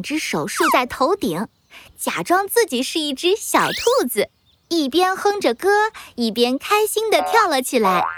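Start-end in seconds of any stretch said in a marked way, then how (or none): none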